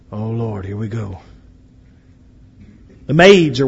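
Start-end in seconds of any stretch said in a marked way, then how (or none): 0.1-1.2 quiet aside. Acted. Miriam's aside.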